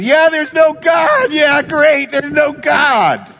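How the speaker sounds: high, loud mocking cheer, slow; mock gleeful atheist. Preacher mocking a happy atheist, high mock cheer. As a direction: ham up the gloating unbeliever.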